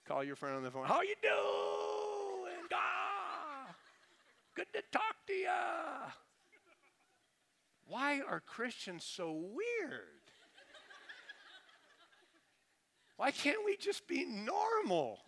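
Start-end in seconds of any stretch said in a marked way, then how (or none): 0.9-6.1 very high, slow, stretched-out wail; hammed-up agonised praying on small talk; mock wailing prayer voice. Calling a friend on the phone, absurd wailing prayer voice. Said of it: "do you talk like that?". 7.9-15.2 high, slow, drawn-out plea; comic exasperation. Real, not acted. Preacher to congregation about weird praying, comically exasperated.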